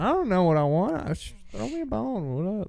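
high-pitched customer plea; playful pleading. Acted. Role-played customer pleading for a pitch.

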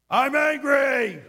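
loud, high, drawn-out angry outburst; hammed-up Hulk-style anger; acted angry roar. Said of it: "programmed to be sometimes just angry".

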